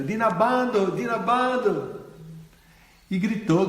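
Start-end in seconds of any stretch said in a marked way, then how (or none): 0.0-2.0 put-on a child calling a name through the woods. A narrator's reading. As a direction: call his name into the trees. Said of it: "entrou na floresta gritando".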